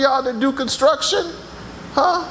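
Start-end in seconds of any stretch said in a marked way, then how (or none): none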